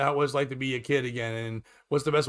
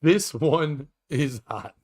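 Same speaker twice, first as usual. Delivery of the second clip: usual speaking voice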